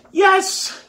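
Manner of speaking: very high, loud squeal of agreement; overjoyed. A narrator's reading. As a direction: burst out with uncontainable joy. Pupil bursting with happiness.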